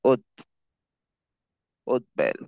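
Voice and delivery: উদ্বেল broken after "ud", pause, "ud-bel"; pronunciation demo. Shown as a demonstration. Teacher showing the retained b in উদ্বেল, pronunciation demo. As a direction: split উদ্বেল to keep the b. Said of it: "যেমন এটা হবে".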